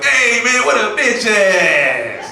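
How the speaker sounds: high, loud mock homeboy call; loud buddy yelling across the driveway; homeboy shouting. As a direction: hype it up like the loud friend. Homeboy voiced jumping up.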